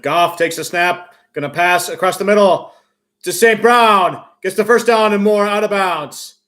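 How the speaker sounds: loud, rising football call; live play-by-play. Real, not acted. Announcer calls a completed pass to fans, loud play-by-play. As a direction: call the catch loudly as it happens.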